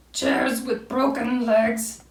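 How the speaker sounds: slow, drawn-out owl lament; mock sad Owl. A narrator's reading. Owl thinks of sad things, slow mock lament. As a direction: dwell mournfully on each word in character. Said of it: "think of things that were sad".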